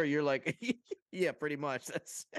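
amused, giggly and high; laughing. Real, not acted. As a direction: agree while cracking up. Amused after own impression.

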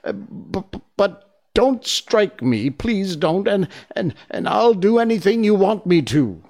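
high, frightened stammering plea; trembling voice. A narrator's reading. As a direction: beg in a fluster, tripping over words. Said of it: "said the little man in a trembling voice".

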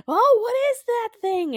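high, loud B-movie cry; mock horror. People on screen spot the monster, hammed-up horrified cry. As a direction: wail it in put-on terror.

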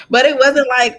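breaks into a laugh mid-phrase. Real, not acted. A woman laughing through her testimony about the voices.

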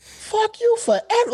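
high, slow, drawn-out curse; hammed-up outrage, way up high; mock fury. Angry about a setup.

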